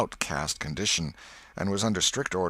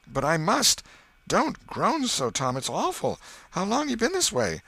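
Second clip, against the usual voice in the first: higher, worried questions; frightened; 10 semitones higher, 4 dB louder than usual. Acted. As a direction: plead with growing alarm. Frightened brother at sickbed.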